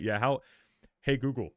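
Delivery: normal talking voice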